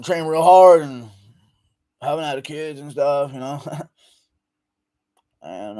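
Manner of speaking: put-on slurred fighter voice, slow in places; hammed-up Nick Diaz rambling; Nick Diaz impression. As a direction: mumble like a dazed fighter at the mic. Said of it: "Nick Diaz will pick up the mic".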